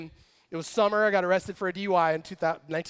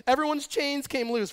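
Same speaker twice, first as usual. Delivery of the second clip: usual speaking voice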